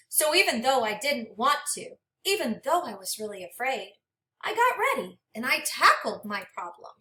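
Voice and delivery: gentle, expressive storybook read-aloud; reading to children. Acted. Teacher reading a storybook to her class, expressive and gentle. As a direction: read it like a picture book for a class.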